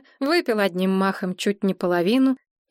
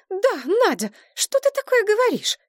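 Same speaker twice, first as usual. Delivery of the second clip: much higher, outraged friend; indignant Maria; 14 semitones higher, 3 dB louder than usual. A narrator's reading. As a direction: protest in a high, shocked voice. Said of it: "возмутилась Мария". (Мария возмущается, голос намного выше)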